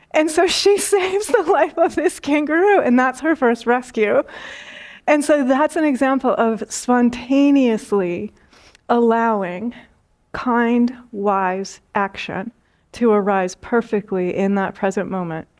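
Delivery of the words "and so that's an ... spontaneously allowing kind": normal talking voice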